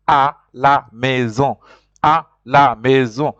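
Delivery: "à la maison" slow and clear, the à open; slow French model. Shown as a demonstration. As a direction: model "à la maison" slowly for learners. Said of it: "A with something on top".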